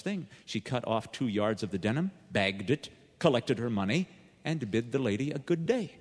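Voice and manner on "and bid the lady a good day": ordinary delivery, nothing marked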